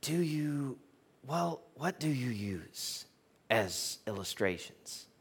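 soft, slow questioning with long hesitations; Nick Costas. Retold or imitated. Pastor imitates the club owner asking about illustrations, soft and halting. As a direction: imitate a halting man feeling out his question. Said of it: "and he goes".

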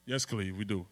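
low, soft mock response; subdued congregation reply. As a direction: mimic a quietly nodding congregation. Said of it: "Y'all just nod".

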